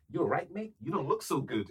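a touch of a caring flatmate; imitating Chris. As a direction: flatmate checking on a hungover friend, lightly imitated. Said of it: "he'd be like".

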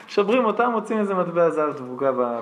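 usual speaking voice

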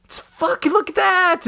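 very high, crying stammer; the wife's terrified outburst; panicked wife. Retold or imitated. Wife points neighbors to the body, high teary panic. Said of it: "my wife's like".

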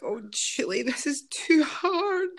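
high, drawn-out mock wail; acted-out despair, stretching the last words; overwhelmed wail. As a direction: plead with a friend like you can't cope. Retelling her plea to a friend.

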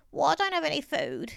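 put-on high-pitched old man admitting; Mister Braithwaite. A narrator's reading. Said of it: "admitted Mister Braithwaite".

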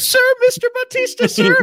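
bow and scrape to the star; fawning executive. Pretend. Mock studio exec greeting.

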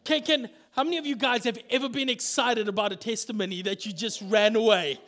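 normal talking voice

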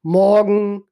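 morgen stretched long, R nearly swallowed; long drawn northern morgen with a swallowed R. Shown as a demonstration. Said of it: "ein lang gezogenes Substantiv".

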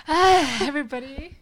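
worn-out sigh of a greeting; exhausted. Retold or imitated. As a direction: drag it out like you're spent. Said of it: "Why do you sound so exhausted".

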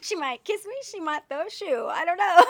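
laughing. Retold or imitated. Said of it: "He's like".

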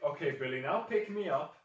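loud, high instruction to a pet; beast-handler's order. Acted. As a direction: tell your beast what to do, brightly projected. Figure orders Billy to lift him.

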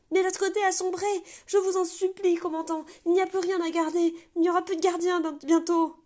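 high, loud, panicked cries through a door; desperate pleading shout. Acted. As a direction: beg to be let in, terrified. Gardien supplie, désespéré. (gardien affolé qui supplie qu'on ouvre la porte, hurlant de terreur)

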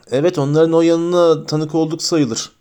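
ordinary delivery

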